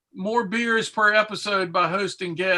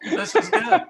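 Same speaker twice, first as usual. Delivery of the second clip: laughing; 13 semitones higher, 3 dB louder than usual. Real, not acted. Laughing repeat, much higher.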